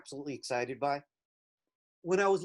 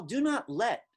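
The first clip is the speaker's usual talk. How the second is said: "do not let" said higher and louder with rising "not"; phrase as a block; 6 semitones higher, 4 dB louder, slower than usual. Shown as a demonstration. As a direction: set "do not let" apart as one punchy block. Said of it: "is a complete thought".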